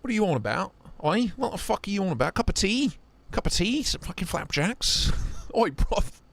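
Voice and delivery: playful cockney jab at a friend; mock British accent. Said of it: "the Queen's English".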